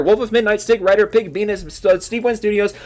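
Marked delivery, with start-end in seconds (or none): none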